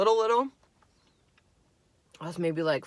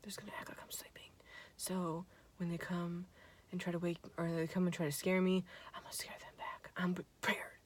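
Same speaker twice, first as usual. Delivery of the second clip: soft, fast conspiratorial whisper; sneaky whisper, lying still in the dark; whispered scheming; 17 dB quieter than usual. Retold or imitated. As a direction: whisper the plan under your breath.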